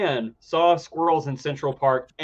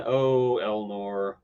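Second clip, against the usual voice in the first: normal talking voice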